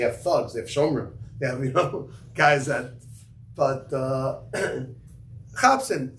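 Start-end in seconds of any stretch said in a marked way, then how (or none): 5.5-6.2 Yiddish accent. Shown as a demonstration. Rabbi repeating the Yiddish call for his students, light Yiddish accent. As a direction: toss off chapsim in a hint of a Yiddish accent. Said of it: "Yiddish accent".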